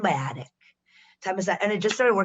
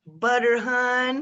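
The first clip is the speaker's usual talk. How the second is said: put-on Southern accent; 4 semitones higher, much slower than usual. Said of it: "Do you do characters?".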